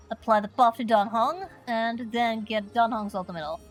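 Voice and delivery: ordinary delivery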